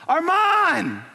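loud, high call of the name. Retold or imitated. Exhausted father calling his buried son, loud high shout. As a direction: shout it down into the rubble, hoping. Father calls after hours digging.

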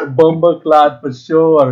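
loud, drawn-out fake Jamaican accent; mock Jamaican accent. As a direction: join in with a big, slow Jamaican put-on. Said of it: "sounds like one of the Jamaican pirated podcasts".

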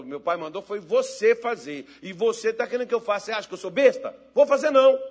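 mock sibling refusal; defiant refusal. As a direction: retort like an affronted brother, ending high and loud. Brother refuses an order in story.

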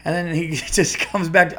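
normal talking voice

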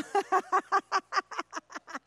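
real high burst of laughter. Real, not acted. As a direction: laugh out at the memory. Laughter after son's reaction.

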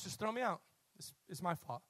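low, soft voice; subdued, take the blame softly; quiet resigned admission. Acted. Retelling Jonah's quiet admission.

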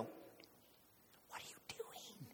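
slow, drawn-out whisper; imitated hushed reproach, very slow; aghast whisper. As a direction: whisper it like an appalled friend. Buddy aghast at outburst.

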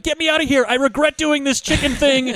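high-pitched, hammed-up regret; mock panicked plea. Guy upside down in the car, mock panicked plea. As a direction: plead for rescue in mock distress. Acting trapped man regretting chicken game.

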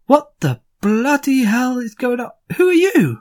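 high, shouted alarm of a startled miner; high indignant shout. Acted. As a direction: yell it like a worker caught off guard.

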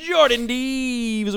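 booming wrestling ring announcer. Acted. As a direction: stretch the name out like a ring intro. Host announces guest with build.

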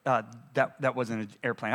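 low, soft, hesitant correction; low-pitched, subdued acted reply; imitated calm husband. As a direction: quieten right down, voicing a calmer man. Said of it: "he was a lot calmer".

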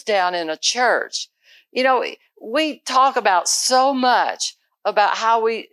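luider, vurige preek tot de gemeente; preaching. Real, not acted. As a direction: preach it with conviction. Preaching to the congregation.

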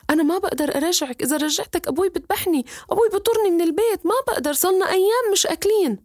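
a lightly voiced boy afraid of his father; anxious boy. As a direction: Omar refusing the fish, high and anxious. Boy refuses, fearing his father.

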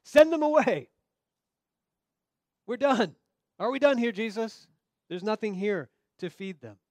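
loud, high, exasperated order; impatient command. Acted. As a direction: bark it like tired disciples fed up. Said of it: "This is an imperative. This is a command".

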